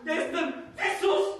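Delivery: loud and high, hurt protest; indignant shout. Acted. Paweł to Alicja denying he is a mummy's boy, indignant shout. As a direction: shout the denial in wounded pride.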